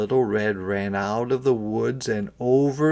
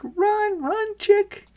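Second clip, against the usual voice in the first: very high voice urging the chick; urgent urging; slower than usual. A narrator's reading. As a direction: cheer the chick away from the hawk.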